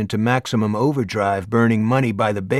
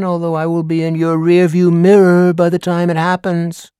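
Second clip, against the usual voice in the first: higher, louder, grandly orating schoolteacher; theatrical teacher; 5 semitones higher, 5 dB louder than usual. Acted. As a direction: perform the lecture like a showman teacher. Teacher Ricker lecturing class.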